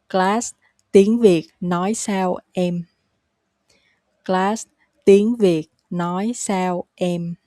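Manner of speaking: slow, each word set apart; slow teaching pace. Shown as a demonstration. Teacher shows how to ask your wife, slowly. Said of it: "now the natural, uh, pace".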